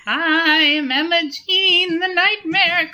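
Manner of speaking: high, slow ghostly wail; haunting spectral queen voice. Acted. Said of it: "this ghostly voice".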